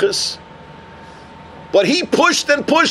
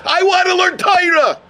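high, impassioned cry without real sobs; tearful insistence, acted; 8 semitones higher than usual. Crying student stands before the rabbi, high insistent plea. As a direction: beg in a high, determined voice. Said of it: "stands in front of Rabbi Yochanan ben Zakkai crying".

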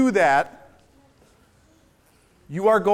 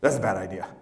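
low, dry warning from the back of the head; a low little voice that knows better; little inner voice; 13 semitones lower, faster than usual. Pretend. The speaker's little inner voice warning him, low. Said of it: "It went something like this".

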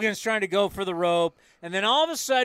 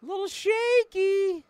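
mocking high voice. Mock taunting the Astros.

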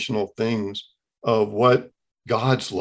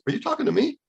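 high, surprised question; can't believe she's talking to him; taken aback, voice jumping up; startled disbelief; 11 semitones higher, 3 dB quieter, much faster than usual. Retold or imitated. Stunned by his wife speaking.

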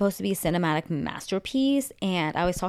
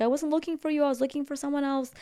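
much higher, light mock girly voice; defensive paparazzo; 10 semitones higher, faster than usual. Paparazzo telling Timothy she wasn't looking for him, high mock voice. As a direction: protest your innocence up high.

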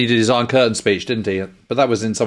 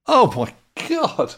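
drawn-out, much higher gasp of disbelief; amazed; 12 semitones higher, much slower than usual. Real, not acted. Hearing the host learns all of Hamlet, slow stunned amazement. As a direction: stretch it out, stunned. Hears he learns whole play.

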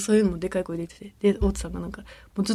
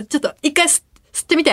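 eager invitation; 7 semitones higher, 4 dB louder, faster than usual. Retold or imitated. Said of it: "って言って".

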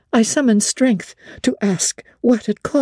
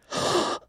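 shocked gasp; 9 dB quieter than usual. Acted. Hearing of the death.

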